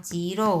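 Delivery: "চিরো" opened with a ch sound. Shown as a demonstration. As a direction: start "চিরো" on voiceless চ. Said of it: "চয়ের মতো উচ্চারণ করে". ("চিরো" চয়ের মতো উচ্চারণ)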